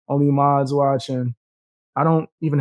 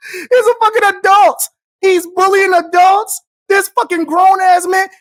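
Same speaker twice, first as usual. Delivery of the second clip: high, loud, laughing rant; mocking shout at a commenter; 14 semitones higher, 6 dB louder than usual. Real, not acted. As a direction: yell it out while still laughing. Streamer ranting at chat.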